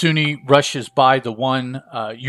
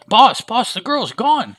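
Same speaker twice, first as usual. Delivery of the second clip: high, loud alarm; alarmed exclamation; 11 semitones higher, 5 dB louder than usual. A narrator's reading. Henchman reporting the girl's escape, alarmed. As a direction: cry it out urgently to the boss.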